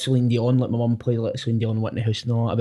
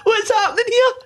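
much higher, half-laughing, half-crying cry of disbelief; stunned excitement. Retold or imitated. Telling how [name] asked him for a photo, stunned high-pitched shout. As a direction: squeal it out, laughing and near tears.

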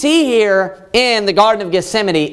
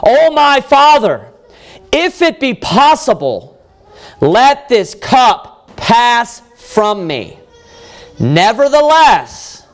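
loud, raised plea with drawn-out words; crying out in prayer; 4 semitones higher, 4 dB louder, slower than usual. Acted. Preacher voices Jesus praying in the garden, crying out. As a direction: cry the words out slowly and forcefully to God. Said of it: "he's crying these words out".

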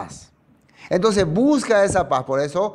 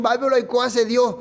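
mock desperate; 6 semitones higher than usual. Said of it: "Todo desesperado".

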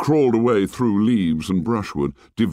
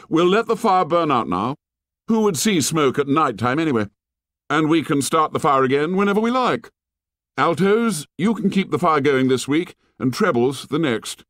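put-on raised, bold boy leader voice; Jack's voice; 8 semitones higher than usual. A narrator's reading. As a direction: play the confident young leader. Narrator voices Jack.

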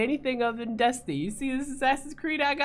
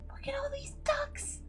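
whispered laugh; 10 dB quieter than usual. Real, not acted. Breathless laugh.

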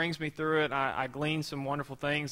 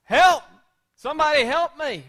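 loud, high cries for rescue; acted desperate shouts into the distance; yelling for help; 10 semitones higher, 10 dB louder than usual. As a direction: yell out like someone in danger. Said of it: "he starts yelling out like we would".

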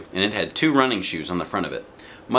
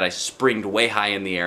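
plain reading voice

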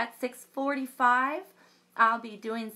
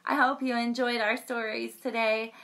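plain reading voice

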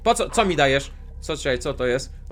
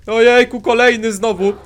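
louder, higher alarmed exclaiming; startled; 5 semitones higher, 9 dB louder than usual. Real, not acted. Gamer showing viewers another monster, alarmed. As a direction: gasp at the new threat.

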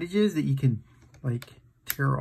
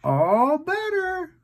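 high, slow cooing all better; soothing; 11 semitones higher, slower than usual. Acted. Uncle comforting the elephant, high slow coo. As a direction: sing it out gently to soothe.

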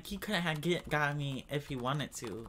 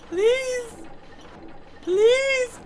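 high desperate pleading; 11 dB louder than usual. Real, not acted. Begging while caught.

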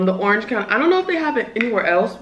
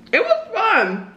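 coy; 12 semitones higher, slower than usual. Real, not acted. Coy high pitched.

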